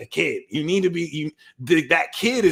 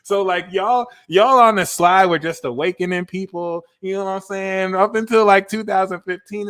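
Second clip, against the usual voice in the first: raised, animated rant; channeling Cancer; 6 semitones higher, 5 dB louder than usual. Acted. Tarot reader speaking as Cancer, animated and raised. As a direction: keep the fired-up Cancer energy going. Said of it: "I'm channeling Cancer right now".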